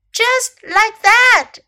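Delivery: high, loud, drawn-out exclamation; crying out. A narrator's reading. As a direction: proclaim it slowly and high. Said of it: "cried Snake".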